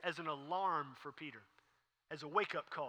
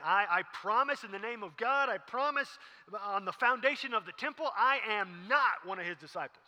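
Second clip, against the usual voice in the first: loud, raised, insistent promise; emphatic oath; 4 semitones higher, 8 dB louder than usual. Acted. Panicking Peter denies Jesus to bystanders, loud emphatic oath. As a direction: swear it loudly, pleading your case. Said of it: "he's issuing promises here".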